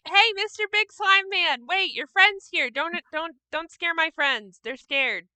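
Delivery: pleading with slime. Acted. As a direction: sweet-talk the big slime, lightly voiced. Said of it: "Kira's gonna say".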